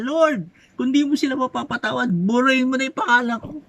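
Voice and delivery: high, pleading cry to God; pleading Moses. Acted. Preacher voices Moses begging God for the people, high and pleading. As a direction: beg the Lord to spare them. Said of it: "sabi niya".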